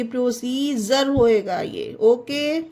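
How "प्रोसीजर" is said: प्रोसीजर with "jer" at the end; प्रोसीजर, D read as ज; correct pronunciation. Shown as a demonstration. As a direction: model प्रोसीजर with a J sound. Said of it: "जा पढ़ेंगे".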